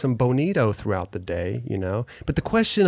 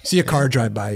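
ordinary delivery

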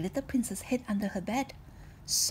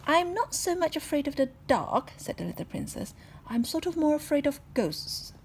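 put-on higher, louder childish explanation; princess voice; 7 semitones higher, 5 dB louder than usual. Princess explains her fear to the maid, high. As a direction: explain earnestly like a little girl.

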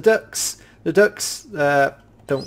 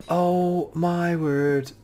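awed drawl; 4 semitones higher, 4 dB quieter, much slower than usual. Real, not acted. Awed by a new find.

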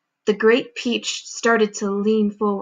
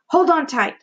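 higher, urgent call; excited warning; 6 semitones higher than usual. A narrator's reading. Grasshopper warning the creatures, excited call. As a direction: warn them, thrilled and bouncing. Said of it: "hopping up and down with excitement".

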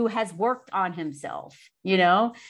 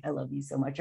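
soft tender declaration; 6 semitones lower, 9 dB quieter than usual. Retold or imitated. Surprising husband with affection.

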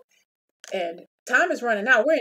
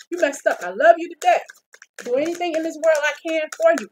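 much louder and higher, a mad but loving partner; acted outburst; 7 semitones higher, 6 dB louder than usual. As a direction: blurt it out loud, hurt and angry.

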